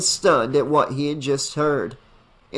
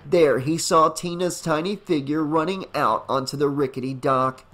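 plain reading voice

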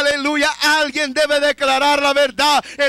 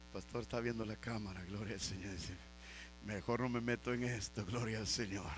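muttered aside; 13 semitones lower, 19 dB quieter, faster than usual. Real, not acted. Joking aside to the pastor.

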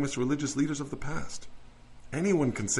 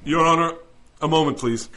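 raised voice, buying time; loud stall; 2 semitones higher, 8 dB louder than usual. Acted. Lawyer stalling the judge in court, loud. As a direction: call out to hold the judge off.

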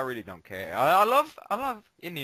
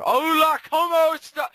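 loud, high hammy Spanish greeting; mock Spanish; 8 dB louder than usual. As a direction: belt out a cheesy hola.

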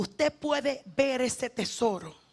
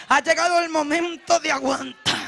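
normal talking voice

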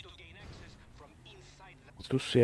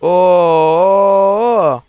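long, loud, higher "Oo" of amazement; surprised exclamation; 4 semitones higher, 12 dB louder than usual. Real, not acted. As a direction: shout a stretched "Oo" at the wreck. Sees wrecked car in game. (uzun, yüksek sesli şaşkın "Oo")

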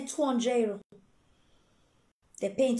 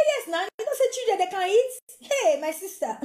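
pleading relative; 7 semitones higher than usual. Retold or imitated. Relative's high pleading reply.

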